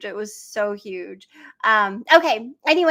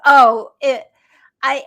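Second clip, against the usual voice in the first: excited; 11 dB louder than usual. Real, not acted. Eager reaction to question.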